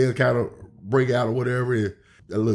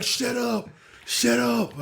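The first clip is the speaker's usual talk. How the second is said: high, strained "Shut up" twice; a hoarse, high mock heckle; imitated angry fans; 12 semitones higher, 3 dB quieter, slower than usual. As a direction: mimic the fans' squeaky protest. Fans yelling at the heel.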